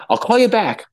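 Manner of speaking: high, hurried phone sign-off; fake phone call. Ending a fake call as she approaches, high and hurried. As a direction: wrap up a pretend call.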